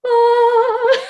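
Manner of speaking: high, loud, drawn-out "aah"; mock awed "ah". Imitating costumer and producer at an opened suitcase, awestruck. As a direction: sing out a reverent reveal. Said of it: "moments where they were like".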